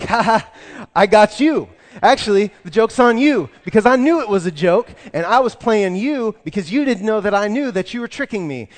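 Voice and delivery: high, loud forced laugh covering embarrassment; fake laughing bluster. Embarrassed customer saving face with the store owner: loud forced laughter. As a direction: laugh it off, pretending you were in on it. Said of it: "I'm laughing and I'm pretending".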